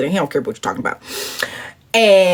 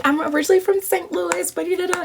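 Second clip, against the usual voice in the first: starstruck answer; 10 semitones higher, 5 dB quieter, faster than usual. Retold or imitated. Voicing her starstruck answer.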